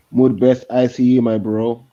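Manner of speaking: usual speaking voice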